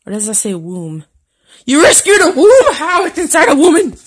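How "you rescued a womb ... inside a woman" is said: loud, high-pitched disbelief; squawking astonished protest; incredulous outburst. Real, not acted. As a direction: exclaim it as if utterly baffled. Mock disbelief at shirt's words.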